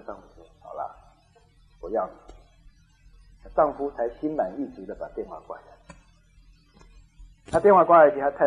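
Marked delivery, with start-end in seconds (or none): 0.6-2.3 very soft, low retold surrender; softened, giving in. Wife yielding to her husband, soft and resigned. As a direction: give in quietly. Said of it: "心就软了".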